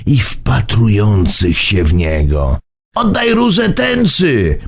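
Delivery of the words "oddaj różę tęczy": strange insistence. A narrator's reading. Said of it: "z dziwnym naciskiem".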